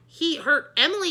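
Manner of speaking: loud accusing yell. A narrator's reading. Andrea accusing the bird, a loud yell. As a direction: yell back in protest. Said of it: "yelled Andrea".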